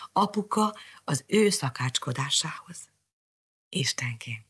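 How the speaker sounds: hushed, breathless wondering; anxious whisper. A narrator's reading. Narrator whispering Mariska's nervous hope. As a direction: whisper it with a beating heart.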